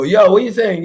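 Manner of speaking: high, loud slangy greeting; street slang greeting. Retold or imitated. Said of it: "I'll get off this and go".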